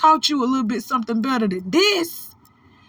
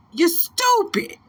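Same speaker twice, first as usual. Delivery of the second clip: high, slow, drawn-out put-down; exasperated insult; 10 semitones higher, slower than usual. Real, not acted. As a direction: let the disbelief spill out high. Scolding Cam in exasperation.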